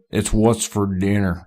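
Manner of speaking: slow drawling imitation of Sam Elliott; Sam Elliott impression. Imitating Sam Elliott for his friends in a slow ad-man drawl. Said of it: "Sam Elliott's like".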